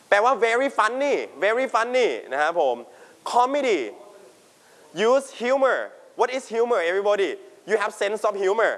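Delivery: ordinary delivery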